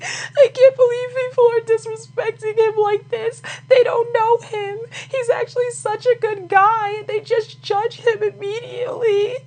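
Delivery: very high, squeaky fake-sobbing whine; mock crying. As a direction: wail it in a hammed-up tearful squeal. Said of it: "Kim's gonna go cry and be like".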